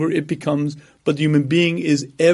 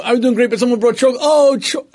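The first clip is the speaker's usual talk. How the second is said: much higher, fast, hammed-up woman breaking down over chocolate; mock craving; 7 semitones higher, 3 dB louder, faster than usual. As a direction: gush like she can't even say the word. Woman too tempted to finish word.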